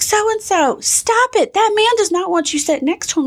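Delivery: high scolding mother voice; mock scolding mom. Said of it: "She's like".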